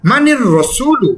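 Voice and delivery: মানির রসুলু slowly, doubled r; slow demonstration. Shown as a demonstration. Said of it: "তাসদিদ দিয়ে পড়তে হবে".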